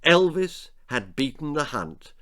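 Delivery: plain reading voice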